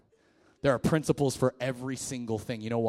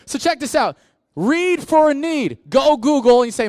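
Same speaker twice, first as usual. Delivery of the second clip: loud preaching; 12 semitones higher, 13 dB louder than usual. Real, not acted. Pastor preaching his point, loud and high. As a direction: drive the point home.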